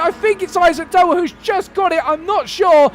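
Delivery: high, loud photo-finish commentary; excited finish call. Real, not acted. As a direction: call the chequered flag at full pitch. Final lap finish, photo finish.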